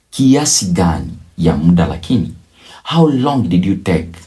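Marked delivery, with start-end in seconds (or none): none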